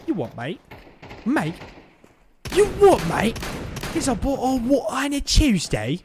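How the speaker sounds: hammed-up, high-pitched British accent. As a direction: do your best cartoonish British accent. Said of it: "hear your best British accent".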